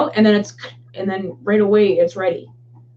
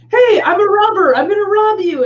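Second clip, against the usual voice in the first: mock robber announcing himself, higher and louder; Jim Jeffries; 8 semitones higher, 5 dB louder than usual. Retelling a comedy bit as the robber, mock loud announcement. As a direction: announce the hold-up loudly in a raised comic voice. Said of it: "And then he's like".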